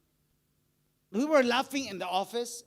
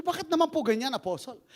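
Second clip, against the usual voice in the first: mock protest; 4 semitones higher, 5 dB louder than usual. Wife protests the apostle's jab.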